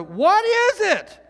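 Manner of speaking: high, loud, slow puzzled question; puzzled Israelites. Acted. Israelites staring at manna, slow puzzled. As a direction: wonder aloud at the strange flakes. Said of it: "they said to one another".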